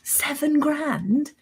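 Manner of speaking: slow, stunned repeat of the price, rising at the end; price-shocked patient echoing the dentist; disbelief. Retold or imitated. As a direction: drag out "Seven grand?" in shock.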